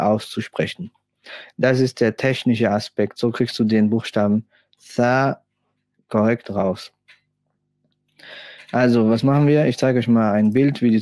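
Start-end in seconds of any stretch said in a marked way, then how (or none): 4.9-5.4 "Tha" mit Zunge zwischen den Zähnen; "Tha" wie ein gelispeltes T; lispelndes Tha. Shown as a demonstration. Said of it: "lispelndes Ta". ("Tha" lispelnd, Zungenspitze sichtbar)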